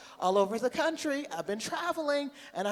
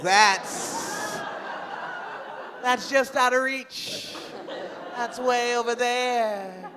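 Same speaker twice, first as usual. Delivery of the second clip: drawn-out whine; 5 semitones higher, 3 dB louder, much slower than usual. Acted. Mimicking condom out of reach.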